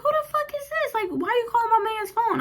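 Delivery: put-on high, shrill girlfriend demanding answers; angry girlfriend. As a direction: mimic the furious girlfriend, high and loud. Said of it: "She's like".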